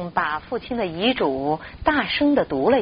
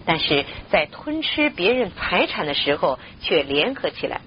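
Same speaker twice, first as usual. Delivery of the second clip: ordinary delivery, nothing marked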